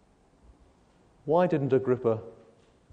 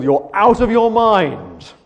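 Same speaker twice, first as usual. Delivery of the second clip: slow, loud, high shout of scorn; Festus shouting; 7 semitones higher, 8 dB louder, much slower than usual. Retold or imitated. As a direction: bellow it like the angry governor. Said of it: "shouts out at the top of his voice".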